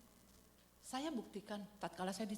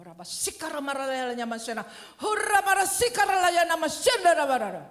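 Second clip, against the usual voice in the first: loud, much higher torrent of tongues; shouting in tongues; 8 semitones higher, 15 dB louder than usual. Retold or imitated. Preacher retells shouting in tongues at the soldiers, full blast. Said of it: "Terus saya ngomong".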